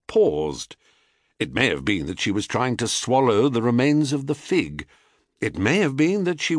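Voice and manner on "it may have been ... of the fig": plain reading voice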